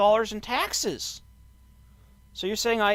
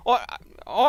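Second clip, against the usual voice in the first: usual speaking voice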